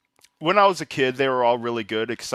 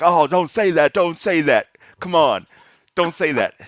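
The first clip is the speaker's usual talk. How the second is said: imitating an upset brother, raised pitch and volume; mock-mad protest, higher and louder; brother getting mad; 6 semitones higher, 6 dB louder than usual. Sister imitates brother refusing to hear about Santa, getting mad. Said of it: "he was just getting all mad".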